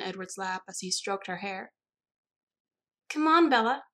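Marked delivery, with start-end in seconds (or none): 3.1-3.8 high, loud, cajoling call; pitched-up, eager coaxing. A narrator's reading. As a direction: coax a reluctant friend along brightly. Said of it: "Alice coaxed".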